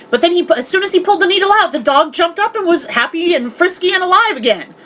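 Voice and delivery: ordinary talk, nothing marked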